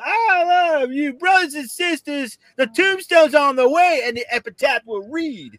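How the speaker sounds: high, slow preacher voice; Brother Love impression. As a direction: preach it like a hammy wrestling manager. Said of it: "Brother Grimace Love".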